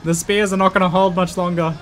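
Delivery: rally your men to hold the bridge; commander's rallying shout. Pretend.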